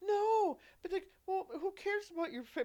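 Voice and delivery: high crying "No, but, like"; mock crying plea. Friend protesting through tears, crying high. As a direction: whine through fake tears. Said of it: "basically telling me".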